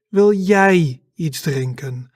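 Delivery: "jij" drawn out and punched; slow question leaning hard on "jij"; stress on JIJ. Shown as a demonstration. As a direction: land heavily on the word "jij". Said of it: "when you want to stress the word".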